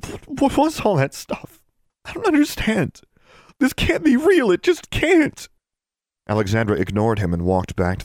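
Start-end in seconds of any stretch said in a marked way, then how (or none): 0.1-5.5 high, bewildered stammer, building louder. A narrator's reading. Shaken boy questions heroine about magic, stammering. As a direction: stumble on the first word, then protest in high distress. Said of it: "Johnny stammered".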